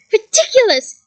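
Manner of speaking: shouted spell. A narrator's reading. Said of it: "he shouted".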